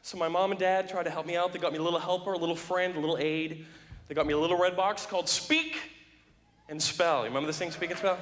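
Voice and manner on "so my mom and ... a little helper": ordinary delivery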